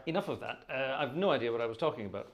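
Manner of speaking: ordinary delivery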